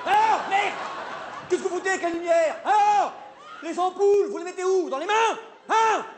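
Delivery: high-pitched comic rant; angry scolding. Acted. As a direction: scold the technician in mock outrage. Acted row with the lighting technician. (engueulade exaspérée, voix haut perchée)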